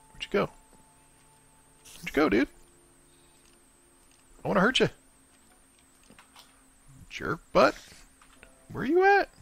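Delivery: high excited taunts at a hiding mob; taunting a skeleton. Real, not acted. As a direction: call out high, itching for a fight.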